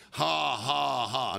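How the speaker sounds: measured mock laugh, dropping low; forced laugh. Answering the devil's taunt, forced laugh. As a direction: imitate a willed laugh at an enemy. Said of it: "That's why I'm laughing".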